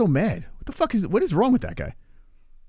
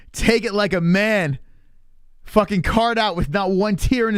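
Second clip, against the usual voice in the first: higher, slow sneering whine; mock whiny hater; 8 semitones higher, slower than usual. Streamer mocking a bitter hater in chat, high sneering whine. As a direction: sneer it out like a sour critic.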